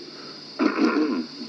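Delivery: real throat clear. Real, not acted. Radio operator excusing himself, clearing his throat. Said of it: "clearing the throat here a bit".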